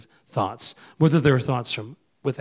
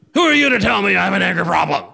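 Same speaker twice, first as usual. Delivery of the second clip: hammed-up defensive outburst, higher and louder; mock angry denial; 6 semitones higher, 5 dB louder, faster than usual. Preacher imitating an angry man denying his temper, loud mock outburst. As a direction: snap back that you're not angry. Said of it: "We say things like".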